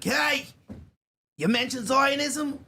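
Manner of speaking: high, yelling kvetch; whiny high-pitched complaining. Retold or imitated. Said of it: "They do talk like that".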